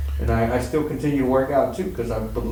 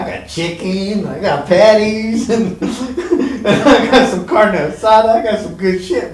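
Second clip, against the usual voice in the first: much higher and louder, laughing through the words; laughing excitedly; 11 semitones higher, 10 dB louder than usual. Real, not acted. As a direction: brag gleefully, laughing as you list it. Bragging about food, laughing.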